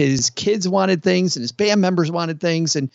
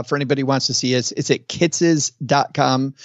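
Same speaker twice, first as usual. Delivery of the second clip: ordinary delivery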